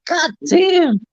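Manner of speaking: a high, amazed "God damn"; high-pitched wow; impressed exclaim. Retold or imitated. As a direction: gasp it out, blown away. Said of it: "I'm like".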